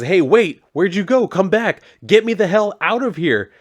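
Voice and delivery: high, loud acted shout after someone leaving; urgent calling out. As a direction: call them back, demanding to be freed.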